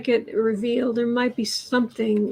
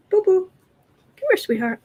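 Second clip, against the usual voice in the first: calling the pet; 7 semitones higher than usual. Real, not acted. Owner calling her cat. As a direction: call the cat by pet name.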